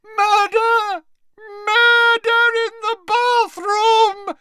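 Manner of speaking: deafening, very high scream; piercing, wailing alarm at top volume; screaming Moaning Myrtle. A narrator's reading. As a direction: shriek it full out, stretching every word. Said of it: "let out a deafening scream".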